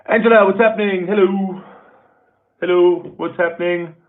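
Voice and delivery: high, loud shout-out to a new viewer; a bright cheer as she joins the live chat; excited welcome. Real, not acted.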